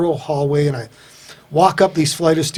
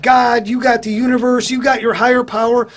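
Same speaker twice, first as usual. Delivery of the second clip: higher pitched, list-like jabs; raised challenge; 8 semitones higher than usual. Retold or imitated. Newcomer challenging the group, in a higher, pointed voice. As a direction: pitch each item up higher.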